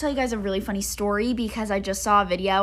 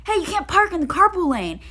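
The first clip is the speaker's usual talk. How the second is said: higher, louder scolding shout; retold scolding yell; 9 semitones higher, 7 dB louder than usual. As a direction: tell off the driver loudly. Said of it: "He's like".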